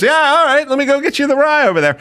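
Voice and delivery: high, quick, eager bartender voice; mock the pushy bartender, quick and bright; a hammed-up eager barman, high and fast.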